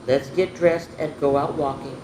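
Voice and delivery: ordinary delivery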